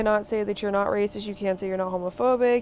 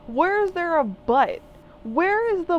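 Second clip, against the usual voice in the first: higher and louder, demanding an answer; incredulous demand; 9 semitones higher, 4 dB louder than usual. Real, not acted. Confronting people who argue with "but", incredulous and raised. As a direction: challenge them to find it.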